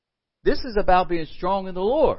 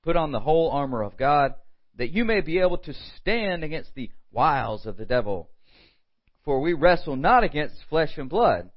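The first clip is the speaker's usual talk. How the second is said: scripture reading; 4 semitones lower than usual. Acted. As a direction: read each verse as if it matters. Reads scripture passage aloud.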